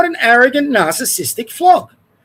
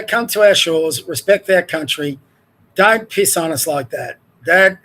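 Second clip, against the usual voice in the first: usual speaking voice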